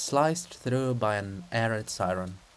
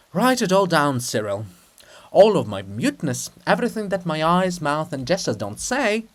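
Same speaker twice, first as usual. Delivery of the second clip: louder, despairing royal lament; anguished princess; 5 semitones higher, 7 dB louder than usual. Acted. Princess pouring her despair out to Cyril, loud and anguished. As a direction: pour out grief, ending sharp.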